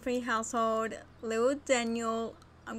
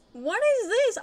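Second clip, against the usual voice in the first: surprised; 10 semitones higher, 7 dB louder than usual. Real, not acted. Confused by unexpected mods.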